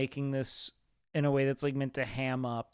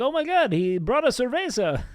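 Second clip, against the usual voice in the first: hammed-up TV host gasp; higher, louder mock amazement; mock excited commentator; 7 semitones higher, 5 dB louder than usual. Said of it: "the whole time was like".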